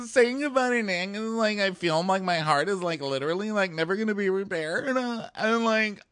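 high, weepy put-on girl voice; mock sobbing Corinne. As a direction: whimper out the heartbreak. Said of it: "But she goes".

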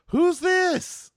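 high, loud mock-cheerful squeal; exaggerated high-pitched phony surprise; mock oblivious greeting. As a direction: squeal it way up high, hamming up the innocence. Imitating DeMario's startled greeting.

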